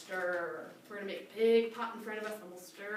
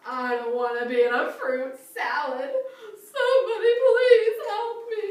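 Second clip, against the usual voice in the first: higher, louder, hammed-up crocodile, panicking over the seed; crocodile character voice; 11 semitones higher, 12 dB louder than usual. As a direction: play the silly crocodile big.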